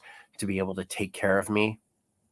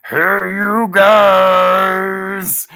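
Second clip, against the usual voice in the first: stretched-out, much higher and louder movie-monster call; Goonies Sloth yell; 12 semitones higher, 13 dB louder, much slower than usual. Retold or imitated. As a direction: bellow it like the big guy from The Goonies.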